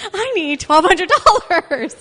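high, loud, drawn-out inner wail; mock desperate outcry. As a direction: stretch out a comic cry of desperation. Retold desperate inner protest.

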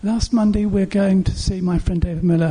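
ordinary talk

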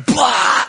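loud high burst of a yell; wild crowd shout, mimicked; imitated crowd yell. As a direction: yell out like a rowdy concert crowd. Imitating crowd yelling at show.

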